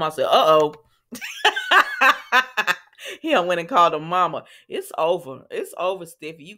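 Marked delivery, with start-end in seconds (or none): 0.3-2.7 uh-oh then laugh. Real, not acted. Reacting with a laugh.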